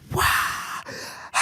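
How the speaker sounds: high, stunned little wow; a hammed-up gasp of shock; mock shocked gasp. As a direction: act out being floored. Shocked reaction retold.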